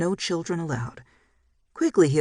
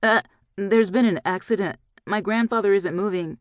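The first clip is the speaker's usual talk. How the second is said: quaking voice; 5 semitones higher, 3 dB louder than usual. A narrator's reading. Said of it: "Isaiah's voice quaked".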